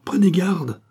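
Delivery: high, loud cry of caution; alarmed warning. A narrator's reading. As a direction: call out to stop the doctor. King warns the doctor.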